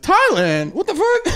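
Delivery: jumps high in pitch with a laugh; stunned tiger. Retold or imitated. Zoo tiger reacts to rescue tiger's story, high laughing shock. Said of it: "He's like".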